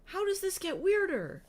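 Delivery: high disbelieving question; can't believe how weird it gets; incredulous. Real, not acted. Reader reacts in disbelief.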